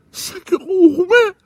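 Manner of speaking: high, loud tearful wail; hammed-up sobbing wail; sobbing cry. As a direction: sob it out. Sobbing confession again.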